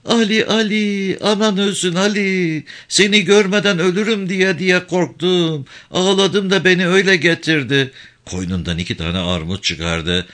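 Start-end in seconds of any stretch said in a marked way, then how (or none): 0.1-7.9 put-on high, whining plea of an old village woman; tearful old mother. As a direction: lament to her son as if half crying. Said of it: "Ağlar gibi söyleniyordu".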